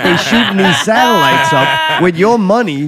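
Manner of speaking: rising, laughing disbelief; laughing outrage. Real, not acted.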